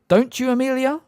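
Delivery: high, smug and mocking; smirking. A narrator's reading. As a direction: sneer it with a smirk. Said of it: "still smirking".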